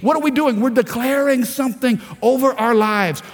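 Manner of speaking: ordinary delivery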